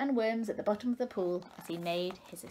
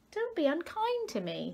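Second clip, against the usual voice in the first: hurt little fish; 9 semitones higher than usual. A narrator's reading. Fish replies to tadpoles.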